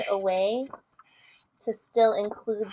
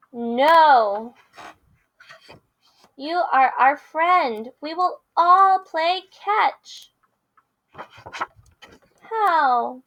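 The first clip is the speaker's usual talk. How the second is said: lively picture-book read-aloud with character voices; reading to children; 5 semitones higher, 5 dB louder, slower than usual. Acted. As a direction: read it big for the kids.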